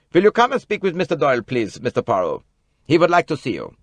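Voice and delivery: lightly voiced German doctor, brisk; the doctor calls Poirot to Simon; doctor's voice. A narrator's reading. Bessner summoning Poirot to Doyle, in a brisk doctor's voice.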